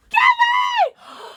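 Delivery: very high, loud yell of a name; excited shout. Real, not acted. Calling out a viewer.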